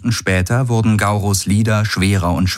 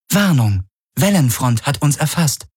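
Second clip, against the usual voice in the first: lightly voiced higher-pitched ship's computer; a hint of automated alert voice; ship's computer warning; 7 semitones higher than usual. A narrator's reading. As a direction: report danger as the onboard system. Ship's computer reports an alarm.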